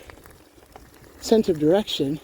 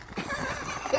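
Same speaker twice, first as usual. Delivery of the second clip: short laugh; 11 dB quieter than usual. Real, not acted. One-handed on gravel.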